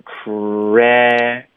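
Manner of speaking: CRA held long, teeth apart on "eh"; wide-open vowel. Shown as a demonstration. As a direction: stretch cra with the jaw dropped. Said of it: "我上下齿之间". (张大嘴拖长 cra)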